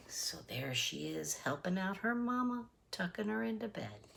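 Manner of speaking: soft murmured commentary; hushed aside. Real, not acted. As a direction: drop the voice to a near hush.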